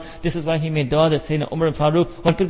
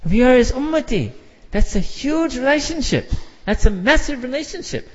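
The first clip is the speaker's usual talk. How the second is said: fervent exclamation; 9 semitones higher than usual. Real, not acted. Fervent about the Prophet.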